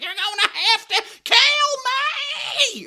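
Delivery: mock Ozark accent shouting through laughter; hammed-up Ozark accent. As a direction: screech it like a girl in Ozark. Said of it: "how they talk on that show".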